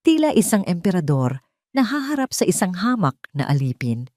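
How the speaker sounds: ordinary delivery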